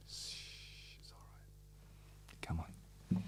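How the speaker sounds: breathy soothing whisper; whispered comfort. Pretend. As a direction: shush him like a crying child. Hushing imagined [name].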